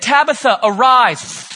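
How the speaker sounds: loud, ringing call to rise; God's command. Acted. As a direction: proclaim it like God raising the dead. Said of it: "God said".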